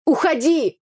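high yell to go away; shouted. A narrator's reading. Upset brother sends sister away, shouting. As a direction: shout her away from the door. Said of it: "выкрикнул Митя за дверью".

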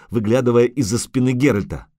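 plain reading voice

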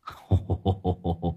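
low, soft rolling chuckle; old gangster's run of ho-ho laughter; hearty cackle. A narrator's reading. Old gangster laughing at the youth's arrest, cackling. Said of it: "Lão Năm cười sằng sặc".

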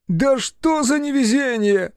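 very high, drawn-out wail of frustration; woeful cry. A narrator's reading. Baron failing to pull on boots, woeful cry. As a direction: cry out slowly at the top of your pitch. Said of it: "отвлёкся на горестный крик".

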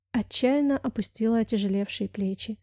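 usual speaking voice

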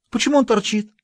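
high mocking schoolgirl voice; girl's voice. A narrator's reading. Pioneer girl mocking the governor, high and taunting. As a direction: taunt him in a high girlish voice.

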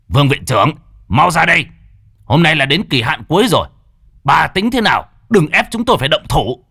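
loud, high-pitched menacing shout; roaring threat. A narrator's reading. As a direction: bellow an ultimatum at the director. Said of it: "Vương Mãnh gầm lên một tiếng".

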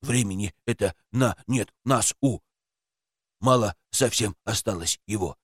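low quiet odd creature; toy creature voice. A narrator's reading. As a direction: speak low like a strange toy.

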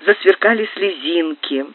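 ordinary delivery, nothing marked

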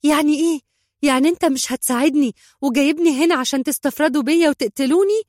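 high, loud panicked questioning; agitated rush of fearful accusation; startled alarm. A narrator's reading. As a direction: demand answers as if cornered. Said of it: "التفتت عائشة منتفضة".

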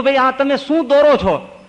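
loud high yelp at the tattooist; pained yell. Retold or imitated. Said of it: "ચીસો પાડવાની". (દર્દથી ચીસ)

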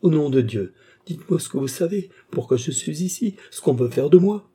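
high-pitched, anxious entreaty; pleading. A narrator's reading. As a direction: beg urgently for the truth. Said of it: "supplia Cerise". (supplication inquiète, voix haute)

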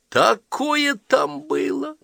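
put-on very high, drawn-out child's exclamation; bursting with news. A narrator's reading. Girl bursts to tell what happened in Moscow, excited. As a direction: marvel it in a high voice. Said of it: "захлёбываясь словами".

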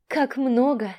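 tipsy, slurred and high; a drunk woman's giddy slur. Acted. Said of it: "пьяным голосом сказала женщина".

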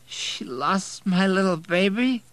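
put-on high, slow tearful drunk whine; drunken tears. A narrator's reading. Drunk man wailing over the lost baby, slow and tearful. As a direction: wail it through drunken tears. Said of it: "drunken tears starting to run".

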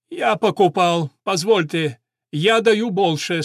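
plain reading voice

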